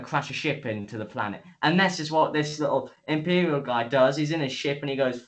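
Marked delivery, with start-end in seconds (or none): none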